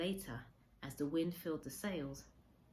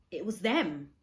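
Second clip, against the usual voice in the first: rising to a loud, higher joyful cry; elated shout of recognition; joyful realization; 4 semitones higher, 13 dB louder, slower than usual. A narrator's reading. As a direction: burst out in disbelieving joy. Narrator recognizing parents.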